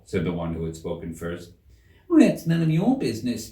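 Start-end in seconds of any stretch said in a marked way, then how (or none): 2.1-3.5 retort. Acted. Seven retorts.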